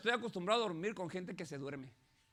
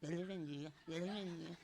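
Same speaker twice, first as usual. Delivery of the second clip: lower, much softer, kind farewell; soft blessing; 4 semitones lower, 10 dB quieter than usual. Real, not acted. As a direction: bless them gently to close. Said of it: "soft blessing closing". (bendición suave de despedida)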